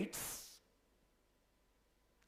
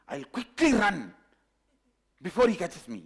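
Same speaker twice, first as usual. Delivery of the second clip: urgent resolve; 3 semitones higher, 5 dB louder than usual. Retold or imitated. Cub decides to dash.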